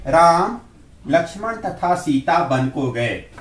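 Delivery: राम held out loud, brief pause, then the rest flows; comma pause after राम. Shown as a demonstration. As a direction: pause after राम, then read on through Lakshman तथा Sita. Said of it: "इसको ऐसे पढ़ेंगे". (राम के बाद अल्प विराम)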